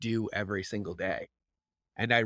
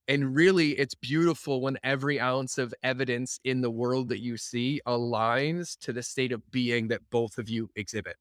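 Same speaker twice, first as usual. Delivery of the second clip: ordinary talk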